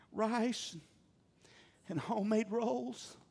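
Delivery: soft, sweet listing of dinner; sweet wife voice. Pretend. Wife greeting husband home softly, sweetly listing dinner. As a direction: softly coo the menu like a pleased wife.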